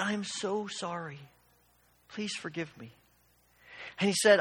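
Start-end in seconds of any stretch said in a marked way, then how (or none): 0.0-2.9 soft, understated choked-up apology; a hint of a professor near tears, said softly; tearful apology. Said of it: "the tears began to run down his cheeks".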